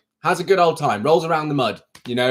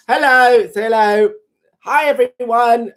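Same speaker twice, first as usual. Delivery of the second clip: pet voice; 7 semitones higher, 4 dB louder, slower than usual. Pretend. Cat talk to viewers.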